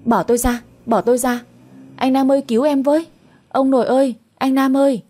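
high-pitched calls for help; acted cry for help, rising in urgency; noisy cries. A narrator's reading. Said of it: "Linh kêu ầm ĩ lên".

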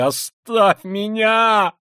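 very high, loud, drawn-out wail; anguished cry. Acted. Killer driving Sonya away, in anguished cry. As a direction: shout it out in convulsive anguish. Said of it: "вскричал он вдруг в судорожной тоске".

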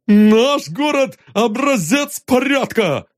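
high proud shout; indignant shout. Acted. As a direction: bellow it defiantly. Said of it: "прокричал Аркаша".

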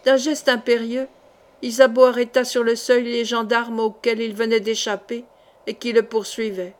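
usual speaking voice

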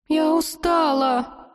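very high, slow little squeak; squeaky voice. A narrator's reading. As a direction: squeak it like a tired little dragon. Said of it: "пропищала Лазурита".

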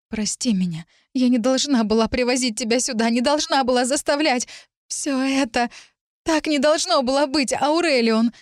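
high-pitched, anguished remorse; distraught apology. A narrator's reading. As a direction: apologise helplessly to the suffering man. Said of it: "извинялась я". (сокрушённо извиняясь, высоким голосом)

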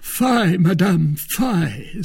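mock disgust. A narrator's reading. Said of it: "as if disgusted".

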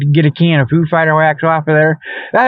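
usual speaking voice

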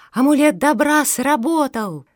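high, loud triumphant cry; jubilant shout. A narrator's reading. Fenya sees the amulet worked, shouting joyfully. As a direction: call it out elated. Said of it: "закричала Феня".

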